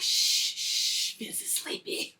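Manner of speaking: hushed, unvoiced shushing; mock secretive whisper. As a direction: shush them as if someone is asleep. Acts homie shushing for sleeper.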